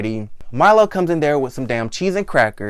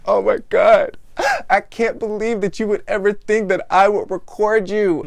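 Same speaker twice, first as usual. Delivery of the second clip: mock crying voice; 6 semitones higher than usual. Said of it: "She starts crying".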